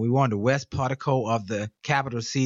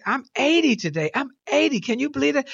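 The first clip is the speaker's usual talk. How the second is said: put-on excited old man; 12 semitones higher than usual. Voicing Wally's excitement.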